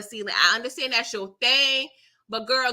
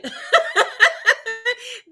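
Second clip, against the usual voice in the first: high laugh; 12 semitones higher, 5 dB louder than usual. Real, not acted. Laugh alone.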